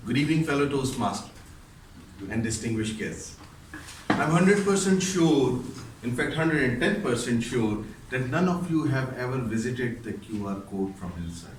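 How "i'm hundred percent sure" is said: slow, loud and high, each word pressed; emphatic claim. Acted. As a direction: lean hard into the certainty. Speech opener, emphatic claim.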